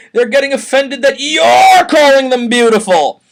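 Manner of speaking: high, loud shout with stress on "you're"; mocking shouted comeback; loud retort. Real, not acted. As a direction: yell it straight at Chris. Retort to Chris.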